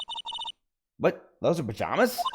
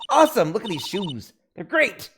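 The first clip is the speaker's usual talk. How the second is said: high, loud delighted cry; excited; 9 semitones higher, 5 dB louder than usual. Acted. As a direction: squeal it with glee. Character thrilled by the shoes.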